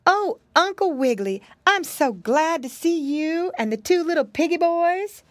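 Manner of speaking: high, gushing lady's voice; cheerful pie lady. Acted. Pie lady greeting Uncle Wiggily, high and gushing. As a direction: greet old friends with delight.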